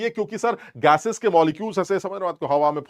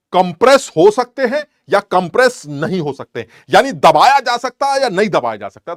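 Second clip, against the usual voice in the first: loud questioning; 5 semitones higher, 9 dB louder than usual. Real, not acted. Teacher quizzing class on compressibility.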